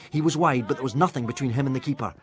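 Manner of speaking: ordinary delivery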